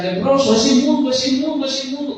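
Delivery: high, loud calling out, fading at the end; herald's call. Retold or imitated. Herald announcing the leper to the crowd, loud calls. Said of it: "anunciando decía".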